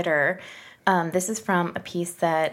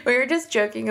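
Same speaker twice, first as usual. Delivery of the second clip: high, breaking up with laughter; real laughing; 10 semitones higher than usual. Real, not acted. Host explaining the heavy breathing joke, laughing. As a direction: try to start explaining through laughter.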